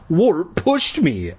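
a boy pleading his innocence, mildly acted; crying out. A narrator's reading. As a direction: cry it out in mild dismay. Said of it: "I cried".

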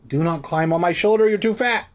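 high, fast scolding of a pet; scolding the cat. Real, not acted. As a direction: shoo the cat off, exasperated. Cat climbing on shoulder.

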